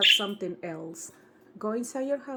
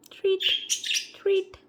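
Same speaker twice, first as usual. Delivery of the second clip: calling a pet; 10 semitones higher than usual. Real, not acted. Calling bird with treats.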